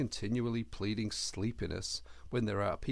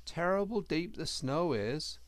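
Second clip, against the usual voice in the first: higher, humble little hedgehog; earnest servant boy voice, higher; young hedgehog voice; 9 semitones higher than usual. A narrator's reading. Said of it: "said the young Hedgehog".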